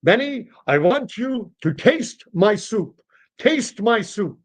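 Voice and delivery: high, emphatic demand; insistent old customer. Acted. Old diner ordering owner to taste soup, insistent. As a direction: lean hard on each word, pitched high. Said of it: "And Mister Rabinovich says".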